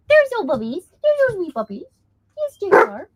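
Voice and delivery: high, cooing talk to the puppies. Real, not acted. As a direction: coo at the dogs like babies. Cooing to the dogs.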